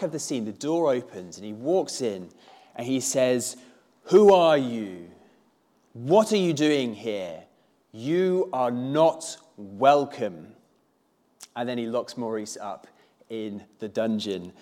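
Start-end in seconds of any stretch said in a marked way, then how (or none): 4.0-10.5 slow, menacing beast challenging an intruder. Acted. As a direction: confront the intruder slowly, drawing out each word. Acts the Beast confronting intruder.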